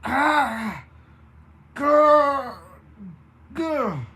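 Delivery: loud, high pained growls; groaning. A narrator's reading. Baroness groans and growls as fins grow. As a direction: groan and growl through the pain. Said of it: "she groans".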